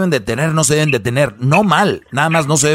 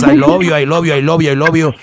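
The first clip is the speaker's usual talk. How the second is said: loud, sing-song I love you; mock a gushing couple; 2 semitones higher, 4 dB louder than usual. Mocking couples' social media posts.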